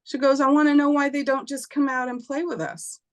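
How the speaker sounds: put-on high, innocent child's answer; little girl voice. Daughter tells her mother about the boys, high and innocent. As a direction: answer matter-of-factly like a small child. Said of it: "girl answers her mother".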